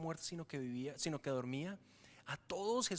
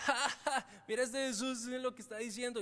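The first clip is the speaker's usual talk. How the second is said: higher voice, laughing "ja, ja" in scorn; mocking laugh; 4 semitones higher than usual. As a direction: laugh at Jesus like a scoffer. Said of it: "a empezar a burlarse de Jesús". (risa burlona, aguda)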